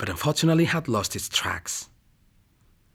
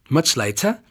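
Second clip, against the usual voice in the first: usual speaking voice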